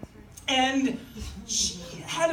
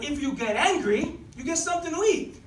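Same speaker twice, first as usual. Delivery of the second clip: put-on Italian accent. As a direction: repeat it carefully in an Italian accent. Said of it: "this nice old Italian man".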